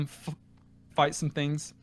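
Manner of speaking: ordinary delivery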